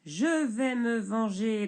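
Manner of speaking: grogné lentement, plus fort; grognement. Acted. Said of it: "grogne le bûcheron". (le bûcheron grogne sa vengeance)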